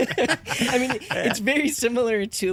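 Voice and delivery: real amused laugh in the words. Real, not acted. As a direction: talk through real laughter. Laughing after co-host's dry joke.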